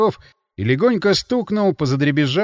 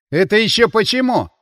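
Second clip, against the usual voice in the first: raised, louder challenging question; disagreeing; 7 semitones higher, 5 dB louder than usual. A narrator's reading. Царько objects to ruling out the union, challenging. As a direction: push back with a sceptical why. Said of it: "не согласился Царько".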